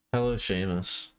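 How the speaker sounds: ordinary talk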